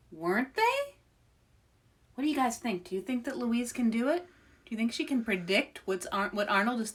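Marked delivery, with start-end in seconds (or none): none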